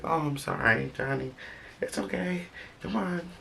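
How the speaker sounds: put-on high, soft, cooing voice to a crying baby; very high, soft, tender comforting; soothing baby talk. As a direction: coo gently to calm the little one.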